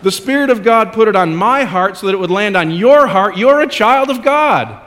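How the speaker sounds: high, loud, rising preacher's declaration; preaching at full force. Acted. Preacher assuring the congregation of who they are. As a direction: proclaim it to the whole room.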